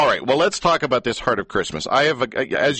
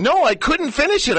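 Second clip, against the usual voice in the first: sudden high squeak of mock despair; mock distress; 3 dB louder than usual. Mock tearful protest.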